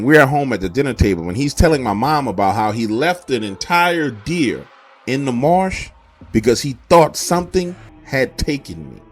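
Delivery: usual speaking voice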